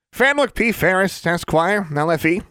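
a bouncy tiny tradesman voice; cheery little wizard. Acted. Small wizard introducing himself to the group, chipper.

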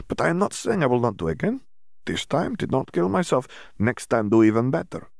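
a hint of an Eastern European accent, earnest; lightly accented Dimitri. Dimitri explaining to Hermione, lightly accented. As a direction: say it with a light foreign lilt.

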